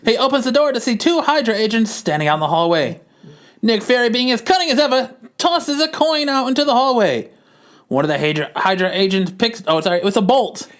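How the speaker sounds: put-on high-pitched old-time narrator reading the scene; old-timey narration. Narrates the comic in a voice.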